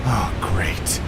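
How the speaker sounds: sarcastic whisper, low; a grumbling whispered oh great; whispered aside. Acted. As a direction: mutter it under your breath. Sour aside on seeing Tyson.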